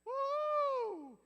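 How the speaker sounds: long, high "woo" at a burn; loud high whoop. Pretend. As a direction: whoop like a crowd hearing a comeback. Reacting to the comeback.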